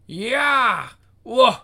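loud, high battle yells; ninja fight cries. Acted. Reader acting out a ninja fight, loud cries. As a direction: shout it like a martial-arts attack.

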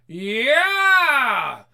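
high shouted scream; terrified scream. Acted. Couple screams at the werewolf. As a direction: scream in terror.

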